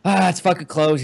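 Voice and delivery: deflated groan of defeat; a mock groan at a closed shop; disappointed sigh. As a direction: sigh it out, let down. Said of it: "we all sighed".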